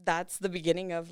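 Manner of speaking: ordinary talk, nothing marked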